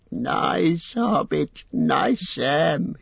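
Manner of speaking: slow, high wheedling creature voice; fawning Gollum. Acted. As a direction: Gollum sweet-talking Sam to win his trust. Gollum fawning over Sam.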